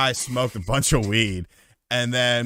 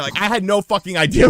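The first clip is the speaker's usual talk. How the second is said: ordinary delivery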